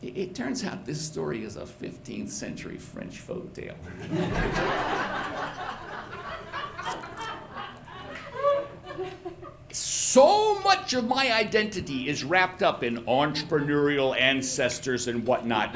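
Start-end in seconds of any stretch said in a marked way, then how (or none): 3.8-9.7 long high laugh at himself. Real, not acted. Storyteller laughing at his own family legend being a folk tale, real laugh. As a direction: laugh it off in disbelief.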